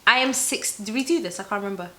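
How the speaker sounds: normal talking voice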